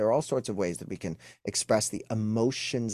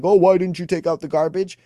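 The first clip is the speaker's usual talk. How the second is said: higher, louder nagging voice; mock accusing wife; 7 semitones higher, 9 dB louder than usual. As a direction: needle him about the garbage. Acted wife's challenge again.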